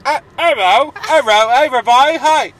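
loud, high-pitched mocking squawk; Mary. Host mimicking co-host's bad Cockney, high mocking voice. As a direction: push it high and loud, over-the-top caricature. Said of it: "doing an impression of you".

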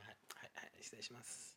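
very soft, near-whispered courtesy; hushed politeness. Real, not acted. Man pouring a drink for his senior, in a hushed polite voice. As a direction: say it low under your breath.

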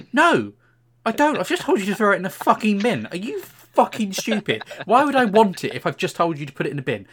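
loud, high, exasperated telling-off; retold furious rant at a shop assistant; angry rant. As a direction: blast the cashier for being stupid.

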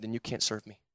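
understated divine reply; voice of God. Retold or imitated. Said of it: "And he said".

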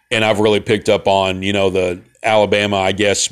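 ordinary talk, nothing marked

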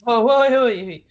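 loud string of startled whoas; shocked disbelief. Real, not acted. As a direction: cut in, aghast and loud. Mock shock at unseen classic films.

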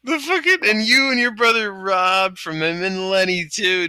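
ordinary talk

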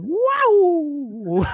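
high, loud Wow into a laugh; excited shout. Real, not acted. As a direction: cheer then laugh. Sarcastic cheer about mishap.

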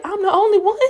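high, stunned question; can't believe you're the last one; squeaky shocked voice; shocked disbelief. Retold or imitated. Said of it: "I was like".

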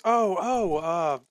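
high, fast and overly friendly; charmed dwarf. Acted. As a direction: gush like a dazed new friend. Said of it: "he says".

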